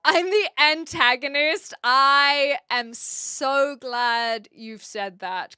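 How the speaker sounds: loud, drawn-out delight; gleeful villain boast. Real, not acted. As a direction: savor being the bad guy.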